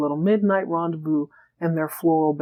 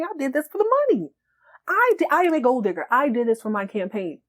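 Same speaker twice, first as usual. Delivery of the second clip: indignant; 6 semitones higher than usual. Retold or imitated. Acted quarrel over canceled campaign.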